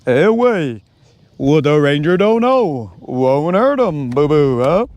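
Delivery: cartoon bear with long swooping vowels, slowing down; brother as Yogi Bear. Brother brushing off Boo Boo's warning, swooping cartoon impression. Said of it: "And it sounds like Yogi".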